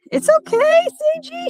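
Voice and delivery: high, soothing pet talk; talking to the dog. Real, not acted. Soothing her whining dog.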